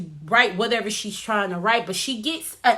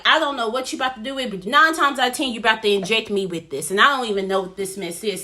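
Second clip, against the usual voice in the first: rapid retort; much faster than usual. Retold or imitated. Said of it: "And Chloe's like".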